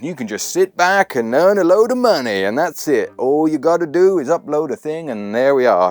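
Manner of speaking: high, loud, hammed-up bad American accent; crap American accent. People in videos selling easy money online, crap American accent. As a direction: put on a mock American hustler's pitch, pushed high. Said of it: "doing a crap American accent".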